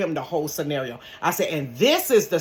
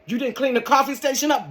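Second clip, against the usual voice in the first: mock scold; 8 semitones higher than usual.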